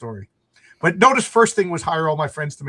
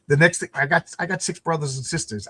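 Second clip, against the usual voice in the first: usual speaking voice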